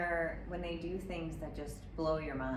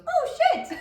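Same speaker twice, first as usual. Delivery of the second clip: put-on much higher, louder little child's curse; toddler voice; 12 dB louder than usual. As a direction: squeak it like a tiny kid copying mom. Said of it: "he goes".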